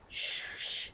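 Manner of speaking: whispered shh to soothe; hushing. Acted. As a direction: hush him gently like a crying child. Soothing a crying boyfriend.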